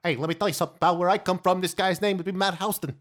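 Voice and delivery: put-on loud, high, fast New York accent; New Yorker accent. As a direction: play a pushy New Yorker, loud and quick. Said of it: "If there was a New Yorker there".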